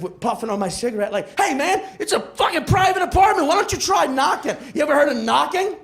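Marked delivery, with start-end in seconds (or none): 1.4-5.8 high, indignant challenge; defensive. Acted. Caught tenant tells delivery guy to knock, defensive and indignant. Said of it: "now I'm defensive".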